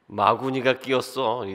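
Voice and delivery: slow, lightly done Gungye; Gungye line. Retold or imitated. Preacher quotes Gungye's famous line, slow and light. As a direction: quote Gungye's catchphrase slowly. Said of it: "그 궁예 말투".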